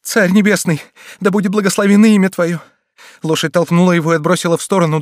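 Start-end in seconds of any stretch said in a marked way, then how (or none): 0.0-2.6 loud, pleading cry to heaven; desperate prayer. Acted. Mother cries to heaven for her fallen son, pleading. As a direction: beg God in fear.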